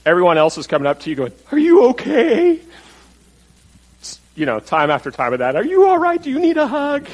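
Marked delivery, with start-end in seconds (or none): 1.5-2.6 high, slow, fussing "are you okay"; high sympathetic busybody voice; concerned onlooker. Retold or imitated. People fussing over crying Joseph. 5.6-7.1 high sympathetic busybody voice; concerned onlooker. Retold or imitated. People fussing over crying Joseph.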